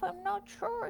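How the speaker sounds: very high gentle squeak; gentle, squeaky. Acted. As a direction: squeak it gently like a tiny critter. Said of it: "he replied gently".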